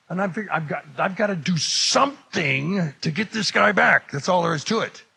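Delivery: high, emphatic angry resolve. Retold or imitated. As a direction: vow payback, worked up. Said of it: "Now I am angry".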